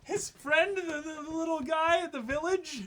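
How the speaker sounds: high, loud, cracking disbelief; incredulous outcry. Real, not acted. Teasing co-host about the name, high incredulous outcry. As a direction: act scandalized he doesn't know. Continues mock outrage.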